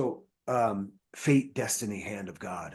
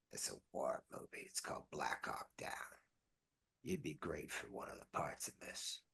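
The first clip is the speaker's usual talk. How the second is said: low hushed whisper; imitating a whisper; 8 semitones lower, 16 dB quieter than usual. Vin Diesel pitching the war movie to Johnny, whispering. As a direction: whisper it like passing on a secret.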